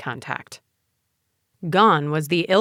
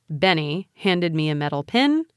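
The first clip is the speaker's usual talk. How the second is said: usual speaking voice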